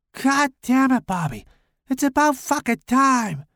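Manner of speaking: put-on very high girlish voice, irritated. A narrator's reading. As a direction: squeak it out like an exasperated little girl. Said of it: "he said in a girlish whisper".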